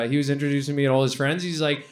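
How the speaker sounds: usual speaking voice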